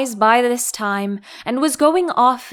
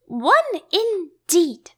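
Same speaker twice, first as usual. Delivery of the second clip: indignant; 6 semitones higher, slower than usual. A narrator's reading. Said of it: "said the Dormouse indignantly".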